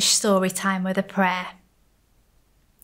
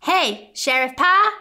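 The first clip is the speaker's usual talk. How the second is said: louder, higher playful shout; 5 semitones higher, 7 dB louder than usual. Acted. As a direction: call out to tease the sheriff. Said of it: "he shouted".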